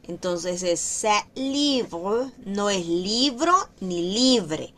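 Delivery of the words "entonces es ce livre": ce livre said slowly, livre with a vowel between O and E; slow French livre; French demo. Shown as a demonstration. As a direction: draw out livre slowly, silent final E. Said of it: "suena entre la O y la E".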